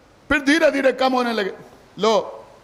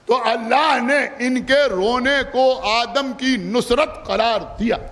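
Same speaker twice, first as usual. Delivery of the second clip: slow, drawn out; slower than usual. Shown as a demonstration. Preacher to mourners, slow drawn-out first telling. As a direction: stretch every word slowly, rising on इनके. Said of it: "सुना आपने या नहीं सुना?".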